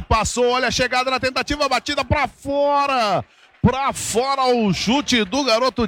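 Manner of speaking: rising shout on the near miss; excited play call. Real, not acted.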